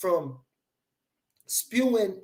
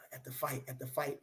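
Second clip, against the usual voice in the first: quiet; 5 semitones lower, 16 dB quieter than usual. Real, not acted. Muttering after a sigh.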